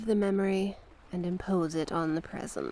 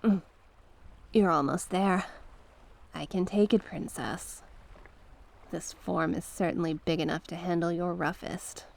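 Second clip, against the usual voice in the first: ordinary talk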